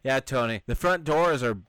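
ordinary talk

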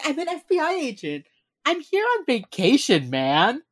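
high, exasperated mock cop-voice; mock Elle. Elle telling the Jamaican detective she's on vacation. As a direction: whine it like a fed-up agent.

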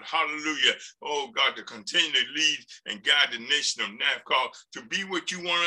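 fervent spoken prayer. Real, not acted. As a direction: pray aloud to the congregation.

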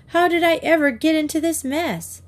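high-pitched lament to oneself; dismayed self-talk. A narrator's reading. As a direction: wail mildly at your own predicament. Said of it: "she said to herself".

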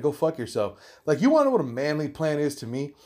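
ordinary delivery

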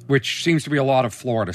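usual speaking voice

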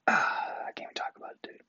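exasperated whisper. Real, not acted. Vlogger gives up on his roommate rant, whispering. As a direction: drop to a defeated whisper. Exasperated aside after sigh.

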